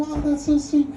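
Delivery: high, gushing coo; doting reaction. Retold or imitated. Father hearing his daughter speak German, gushing. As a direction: coo like a smitten parent. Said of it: "and I'm".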